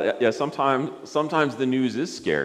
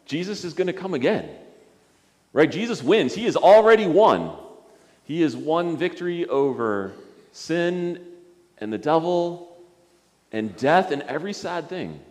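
high, loud triumphant proclamation, slowing on "victory over sin"; preaching; 6 semitones higher, 4 dB louder than usual. Acted. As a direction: proclaim the win to the kids.

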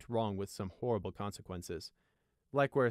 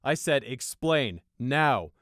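loud, slow, forceful command; higher, emphatic officer's demand; insistent order; 4 semitones higher, 10 dB louder, much slower than usual. A narrator's reading. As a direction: repeat the order, allowing no delay. Captain repeats his ignored order.

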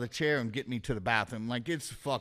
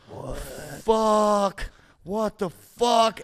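raised, loud, stretched-out exclamation; acted alarmed disbelief; 6 semitones higher, 5 dB louder, much slower than usual. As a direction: reenact being freaked out, drawing each word long.

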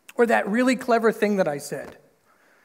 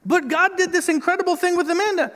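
whiny Israelite; 10 semitones higher than usual. Retold or imitated. Israelites complaining to God in the desert, high mock whine. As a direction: whine like a spoiled grumbler.

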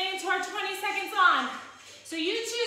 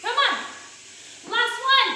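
loud; 4 semitones higher, 6 dB louder than usual. Real, not acted. Final count push.

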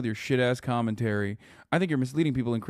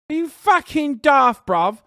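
slow, high, loud mock British rant; mocking a British chatter with a big shout; mock British yell; 13 semitones higher, 10 dB louder, slower than usual. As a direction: roar it like an angry Brit in the pub. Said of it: "kept saying daft".

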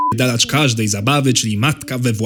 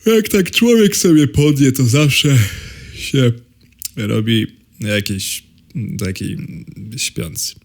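falling asleep; slower than usual. Acted. Baby drifting to sleep.

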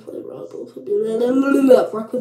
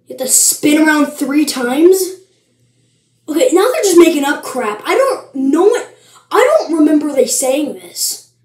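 indignant disbelief; 4 semitones higher, 6 dB louder than usual. Real, not acted. Objects to the made-up rule.

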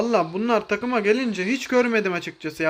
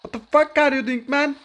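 louder, higher, disbelieving; exasperated yell; 6 semitones higher, 4 dB louder than usual. Real, not acted. Streamer yells at a careless teammate, exasperated. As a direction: throw it at a teammate who messed up.

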